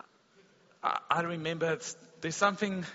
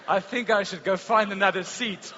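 mock fright; 3 semitones higher, 7 dB louder than usual. Preacher retells bolting from the Russian man, hammed-up fright. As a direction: play up the jumpy alarm for laughs. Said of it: "scared reaction retold loudly".

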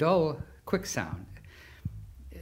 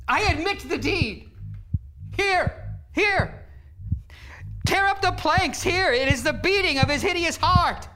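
frantic screamed confession; 13 semitones higher than usual. Acted. Murderer screaming his guilt at the police officers. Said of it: "I felt I must scream or die".

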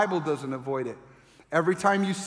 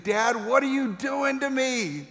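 put-on toddler's plea; 6 semitones higher than usual. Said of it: "he's thinking".

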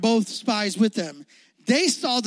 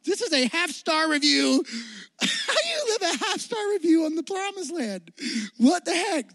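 high, laughing mock outrage; can't believe the review, laughing; laughing disbelief; 7 semitones higher than usual. Preacher to youth group, laughing in disbelief. Mocking the half star review.

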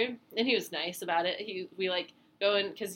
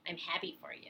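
passive-aggressive; 10 dB quieter than usual. Retold or imitated. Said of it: "it kinda sounds like".

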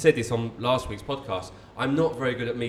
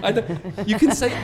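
laughing; 6 semitones higher, 5 dB louder than usual. Real, not acted. Laughing at the mouthed answer.

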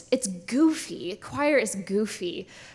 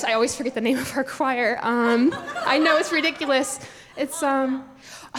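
normal talking voice

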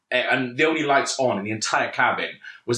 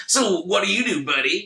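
higher-pitched mock LA guy; douchebag from LA; 7 semitones higher than usual. LA passenger chatting up his seatmate, mock douchebag. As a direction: put on an obnoxious LA seatmate. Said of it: "this absolute douchebag from L A".

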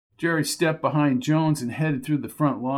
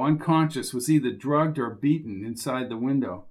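usual speaking voice

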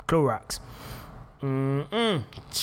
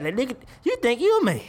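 mock confrontation; 7 semitones higher than usual. Confronting imagined son.

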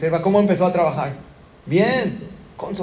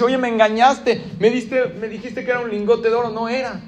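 much higher and louder, indignant; angry accusation; 6 semitones higher, 5 dB louder than usual. Acted. Cheated poor man confronts the shoemaker, loud and indignant. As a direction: shout the betrayal at him.

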